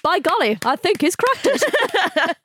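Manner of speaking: high, prissy mock voice of a sudden convert; mocking the co-host. Teasing the co-host about next week, high mock voice. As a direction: send up your co-host's change of heart, pitched high. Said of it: "You'll be coming in next week going".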